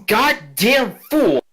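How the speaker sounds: high, slow furious scold; noble lady scolding. Acted. Noblewoman scolding the CEO she slaps with her fan, shrill angry. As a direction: spit the insult out slowly, like a slap. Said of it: "She goes".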